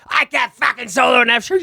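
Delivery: high, loud outraged shout; yelling. Retold or imitated. Guitarist objects to the song's key, yelling at the newcomer. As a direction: holler it in hammed-up outrage. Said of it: "Yelling at me".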